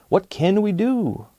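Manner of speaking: a hint of a fussing mayor; mayor asking. Mayor asks the village hall, mildly puzzled. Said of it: "Mayor Muddlenut asked them all".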